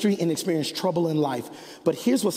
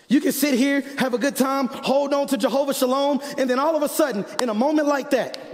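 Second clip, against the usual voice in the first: high, driving sermon voice; raised pitch, urgent build; impassioned preaching; 8 semitones higher than usual. Real, not acted. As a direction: preach it out to the whole congregation. Preaches at full force.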